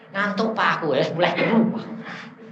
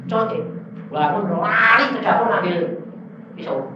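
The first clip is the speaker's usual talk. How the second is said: ordinary talk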